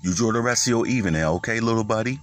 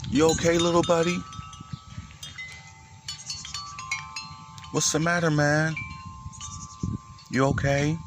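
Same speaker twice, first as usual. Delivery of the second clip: pet talk; 6 semitones higher, 7 dB quieter than usual. Real, not acted. Coaxing the animal closer.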